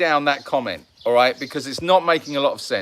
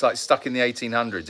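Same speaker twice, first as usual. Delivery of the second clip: ordinary talk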